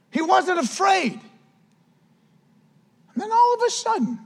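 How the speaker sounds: high, urgent sermon delivery; fired-up preaching. Real, not acted. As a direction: preach it up high, driving each point home. Preaching at raised pitch.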